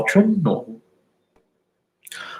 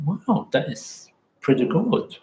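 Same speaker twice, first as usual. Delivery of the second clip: higher and slow, savouring the surprise; slow delighted wow; 8 semitones higher, slower than usual. Shown as a demonstration. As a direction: let the wow land slowly with pleasure.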